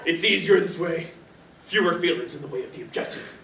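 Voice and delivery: low hushed brooding killer voice; assassin's voice. Acted. [name] tells roommate Cody about the call in his assassin voice, low and hushed. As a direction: mutter it like a grim movie assassin. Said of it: "it's my assassin's voice".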